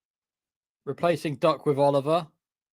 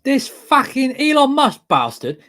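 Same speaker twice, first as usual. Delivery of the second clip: angry; 12 semitones higher, 9 dB louder, slower than usual. Acted. Mock ranting commentary.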